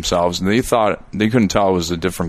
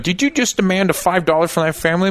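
higher-pitched indignant challenge; mock accusation; 8 semitones higher than usual. Rival Spider-Man accusing him over a tip, mock indignant. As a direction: imitate a suspicious rival confronting someone.

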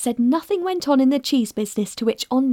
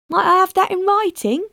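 sweetly; 8 semitones higher, 4 dB louder than usual. A narrator's reading.

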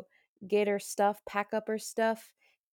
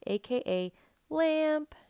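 playful high voice; 8 semitones higher, slower than usual. Acted. Playful aside after podcast name.

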